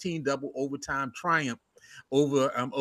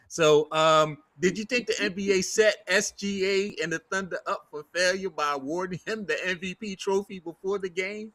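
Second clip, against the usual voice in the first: ordinary delivery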